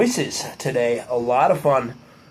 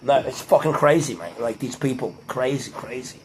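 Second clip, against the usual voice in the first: low, soft Australian "mate" banter; lightly done Australian accent; 5 semitones lower, 6 dB quieter than usual. Shown as a demonstration. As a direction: put on a casual Australian accent, fading away. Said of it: "if I was in Australia though".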